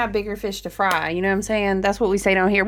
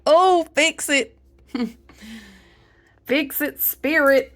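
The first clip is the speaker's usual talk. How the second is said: wincing fix it; 4 semitones higher, slower than usual. Retold or imitated. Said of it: "You was like".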